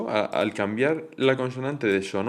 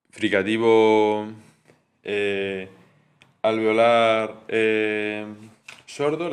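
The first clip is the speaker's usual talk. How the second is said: slow dictation; much slower than usual. Shown as a demonstration. Teacher dictating the terms slowly to the class. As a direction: dictate each term slowly so students can note it. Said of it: "teacher spelling out terms".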